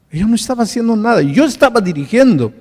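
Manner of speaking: grieving husband cries out to the pastor, anguished and loud; anguished outcry. Retold or imitated. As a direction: cry out the guilt of the crash that killed his wife. Widower's anguished confession, retold.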